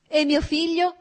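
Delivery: high, loud cry. A narrator's reading. Anna cries out to Vronsky about her son, anguished cry. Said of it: "gridò".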